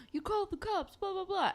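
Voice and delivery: a cartoonish frantic gunman; mock accusing gunman. Gunman accuses Holly J of calling police, high mock accusation. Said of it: "he's like".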